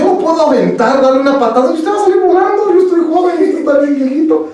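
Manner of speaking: high-pitched, indignant retort; incredulous protest. Retold or imitated. Young man warning off an elderly robber, indignant protest.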